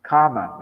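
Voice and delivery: "kama" with no r, loud falling first vowel; corrected pronunciation. Shown as a demonstration. Speaker repeating the right word for listeners, "kama" without r. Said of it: "kama, not karma".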